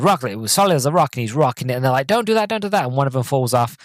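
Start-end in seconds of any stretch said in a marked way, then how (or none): 2.1-2.8 higher mock plea, don't do that; lightly voiced worried porters; porters pleading. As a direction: porters begging him to stop, voiced high. Porters warning Lyle.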